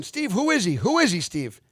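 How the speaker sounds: high, swooping eager questions; retold clamouring. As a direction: pester him like an excited crowd. Retold crowd clamouring to ask Steve.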